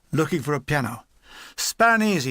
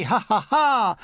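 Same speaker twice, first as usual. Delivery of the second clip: mock laugh; 4 semitones higher, 3 dB louder, slower than usual. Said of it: "mindlessly happy".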